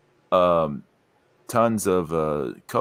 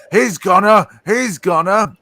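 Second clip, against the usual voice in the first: laughing; 9 semitones higher, 5 dB louder than usual. Real, not acted. Laughing mid-sentence.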